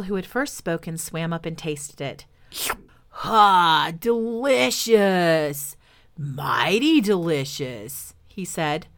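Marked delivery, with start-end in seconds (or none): 3.1-8.1 slow, drawn-out crocodile relish; savoring crocodile. Acted. Crocodile tasting the lollipop, slow and relishing. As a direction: savor every syllable of the sweet.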